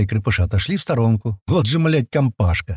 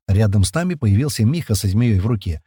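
usual speaking voice

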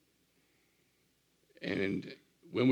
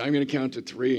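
stern warning; 6 semitones higher, 9 dB louder than usual. Retold or imitated. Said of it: "I said".

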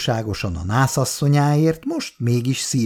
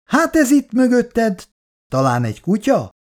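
playful fright; 10 semitones higher than usual. Pretend. Grandmother spotting a hidden child, playfully alarmed. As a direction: pretend to be scared, happily. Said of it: "Ijedezett a boldog nagymama".

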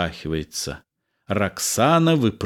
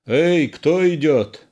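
higher, drawn-out call of a sentry; sentry's challenge; 7 semitones higher, 3 dB louder than usual. A narrator's reading. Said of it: "голос часового".

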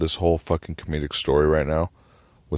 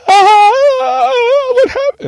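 very high, loud wailing shriek; hammed-up screeching panic; mock hysterical screaming; 13 dB louder, much slower than usual. Lucky runs out past first responders, mock hysterical screaming. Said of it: "Lucky screaming like a".

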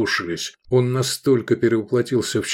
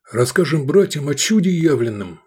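fervent; 6 semitones higher, 4 dB louder than usual. A narrator's reading. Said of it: "горячо одобрили".

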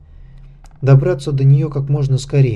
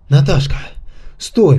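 wheezing and panting; hoarse, out of breath; 3 semitones higher, 3 dB louder than usual. A narrator's reading. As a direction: rasp it out short of breath. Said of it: "прохрипел он, тяжело дыша".

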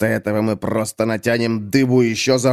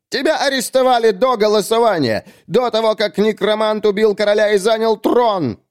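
high, sustained accusing tone; indignant poet; 12 semitones higher than usual. Acted. (возмущённое обличение высоким голосом)

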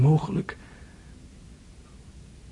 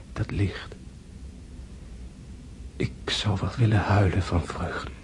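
weak whisper; 3 semitones lower, 4 dB quieter than usual. Acted. Dying André whispering joy.

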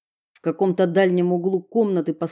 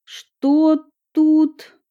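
put-on girl's voice; 9 semitones higher, 5 dB louder than usual. Edison emerges from tunnel.

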